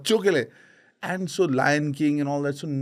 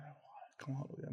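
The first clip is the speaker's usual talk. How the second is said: almost silent breathy amazement; hushed wow; 21 dB quieter than usual. Retold or imitated. Father marvelling at his daughter's joy, hushed whisper.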